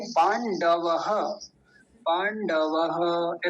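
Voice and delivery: पांडवः drawn out slowly, the final ह sounded; right slow form. Shown as a demonstration. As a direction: stretch पांडवः slowly, voice the ending. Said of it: "ऐसा बोलेंगे". (पांडवः धीरे, अंत का विसर्ग साफ़)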